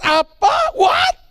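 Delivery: sharp high outraged question; older brother's outraged what; indignant what. Retold or imitated. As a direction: shoot up in disbelief. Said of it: "Waktu dia dengar".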